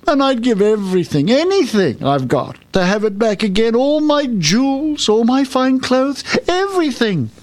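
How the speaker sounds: high wail, rising as it goes; sobbing. A narrator's reading. Princess offering all she has for her ball, sobbing. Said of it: "she sobbed".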